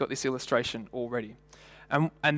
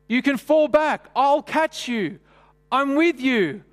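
high, loud, slow calling voice; hammed-up cheerful reassurance; mock calling out; 11 semitones higher, 6 dB louder, slower than usual. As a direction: shout encouragement across the room. Leader calls across the hall.

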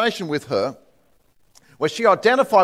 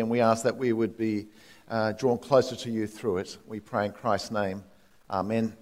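soft, low spoken prayer; quiet prayer; 6 semitones lower, 6 dB quieter than usual. Real, not acted. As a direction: pray gently and humbly. Pastor prays before sermon.

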